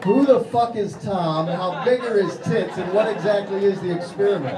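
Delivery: mock outrage. Comic puzzling over a subway poster, loud disbelief. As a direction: demand answers like it's a scandal.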